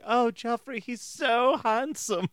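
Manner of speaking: high, drawn-out British swooning; mock British accent. Imitating Sansa gushing about Joffrey, mock British accent. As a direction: gush slowly in a put-on British accent. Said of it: "That British accent".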